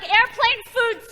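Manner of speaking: put-on very high, slow laughing child voice; toddler voice. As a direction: stretch it out like a whiny kid. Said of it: "She's like".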